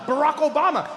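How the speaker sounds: normal talking voice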